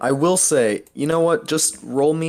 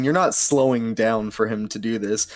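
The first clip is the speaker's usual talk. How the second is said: usual speaking voice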